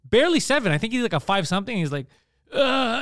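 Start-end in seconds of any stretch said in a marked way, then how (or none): 2.5-3.0 high-pitched panicked stammer; hammed-up panicked noise; mock panic. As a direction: imitate someone freezing up, voice jumping high. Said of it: "and he was panicking".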